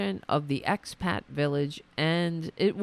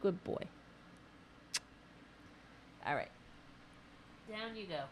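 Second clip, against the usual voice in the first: tender pet praise; soft praise to dog; 18 dB quieter, faster than usual. Real, not acted. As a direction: praise the dog gently and send him down.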